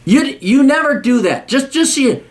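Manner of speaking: high, insistent reprimand; angry scolding. Real, not acted. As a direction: scold in a strained high pitch. Scolding letter writer.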